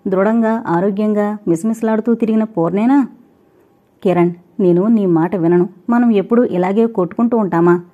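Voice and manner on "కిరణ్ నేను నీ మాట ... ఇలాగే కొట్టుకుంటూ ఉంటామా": plain reading voice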